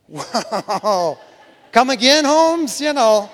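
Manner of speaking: startled disbelief, tossed out with a laugh; laughing surprise. Acted. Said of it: "I would have had enough sense to figure".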